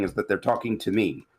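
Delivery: ordinary talk, nothing marked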